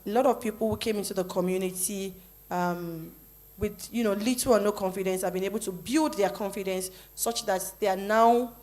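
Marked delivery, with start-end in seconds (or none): none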